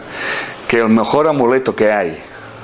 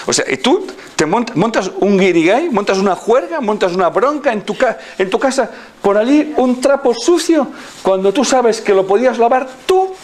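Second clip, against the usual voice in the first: higher, mock-outraged rant; 11 semitones higher than usual. Teasing a friend about her kitchen rag, mocking. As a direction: ham up the fuss over a dirty rag.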